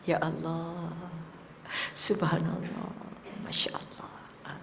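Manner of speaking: hushed, low, moved; tender whispered devotion; soft heartfelt zikir. Shown as a demonstration. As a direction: let each phrase sink into the heart. Zikir reaching the heart, weeping.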